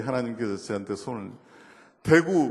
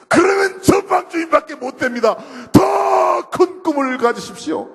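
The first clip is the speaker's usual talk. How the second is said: revival preacher shout; 14 semitones higher, 9 dB louder than usual. Retold or imitated. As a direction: cry out the call at the top of the voice. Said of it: "십 초 동안 고함을 지를 거라고". (목이 터져라 외치는 부흥 설교)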